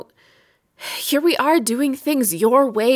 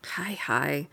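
barely voiced whisper, much softer; hushed greeting; 8 semitones lower, 11 dB quieter than usual. Wife whispering a greeting to her husband in her language. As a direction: breathe the words almost silently.

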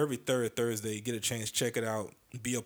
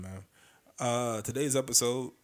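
ordinary talk

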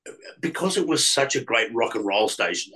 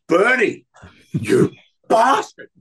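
loud, much higher yell broken by a laugh; caught-out colleague bursting out laughing; laughing outburst; 12 semitones higher, 7 dB louder, slower than usual. Retold or imitated. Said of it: "and he goes".

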